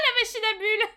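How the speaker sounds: high, loud laughing cry of delight; thrilled laughing exclamation; excited squeal. Real, not acted. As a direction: burst out laughing with glee. Delight at the bubble machine.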